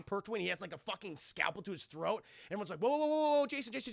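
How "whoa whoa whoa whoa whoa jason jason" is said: high run of urgent whoas; acted alarm; alarmed calming. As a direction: talk someone down in a hurry. Crew restraining Jason, acted.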